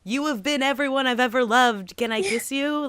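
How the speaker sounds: high, starstruck gushing; gushing fan. Retold or imitated. Fan gushing at voice actors, swooning and high. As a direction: swoon over a favorite voice actor. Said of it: "and you're just like".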